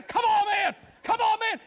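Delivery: high, loud fan shouting at the screen; yelling at the TV. Acted. A fan in the living room urging the wrestler on, shouting. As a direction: urge him on from the couch.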